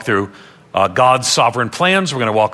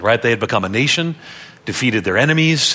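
usual speaking voice

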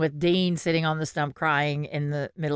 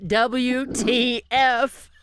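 "W T F" very slow and higher, letter by letter; all caps, separate lines; 6 semitones higher, much slower than usual. Retold or imitated. Reading her friend's all-caps text aloud, "W T F" spelled out slowly. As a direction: draw out each letter of "W T F" as its own announcement. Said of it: "just in all caps and on separate lines even".

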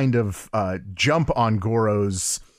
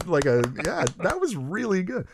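ordinary talk

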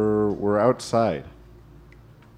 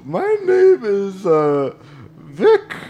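high silly voice; 8 semitones higher, 4 dB louder, slower than usual. Acted. NPC voice.

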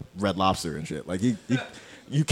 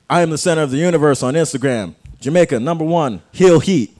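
yelled wrestler-style promo, much higher and louder; hype shout; 8 semitones higher, 12 dB louder than usual. Acted. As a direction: scream the trash talk at the crowd.

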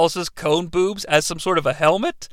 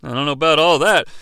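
ordinary talk, nothing marked

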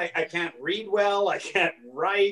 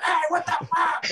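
high, hammed-up startled yell; mock freak-out; 12 semitones higher than usual. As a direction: pretend you nearly got hit, way up high. Pretend near-crash freak-out.

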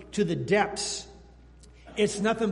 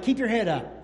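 louder, higher rallying encouragement; encouraging Paul; 5 semitones higher, 8 dB louder than usual. Retold or imitated. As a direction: lift them up from the dungeon. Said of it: "He says something like".

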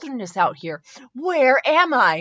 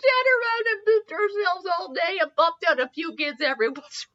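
high voice breaking into laughter; giggling helplessly through the words; laughing fit; 12 semitones higher than usual. Real, not acted. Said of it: "What the?".

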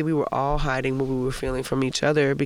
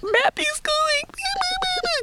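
very high, stretched-out squeaky wail; keen it like an exaggerated sob; imitation crying, words dragged out high; mock cry fit; much slower than usual. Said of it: "like, a cry fit in the back".

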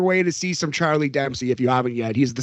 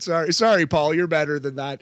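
ordinary delivery

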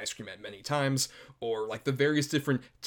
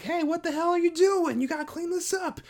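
high-pitched mock scolding; Grandma Stacy; 3 dB louder than usual. Grandma scolds kids for spitting on her car, high mock outrage. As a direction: imitate an outraged telling-off, pitched way up. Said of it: "And she's like".

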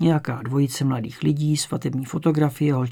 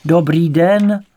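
loud, carrying call; loud repeated greeting; 3 semitones higher, 8 dB louder, much slower than usual. A narrator's reading. Second detective calling into a silent house, loudly. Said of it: "opakoval Kubíček".

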